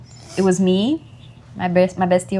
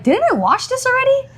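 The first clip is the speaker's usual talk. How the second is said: puzzled high squeak. Retold or imitated. Puzzled mom quoting herself.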